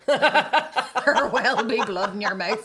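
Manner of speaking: slow, loud threat through laughter; laughing mock threat. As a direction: stretch it out laughing. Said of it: "I said".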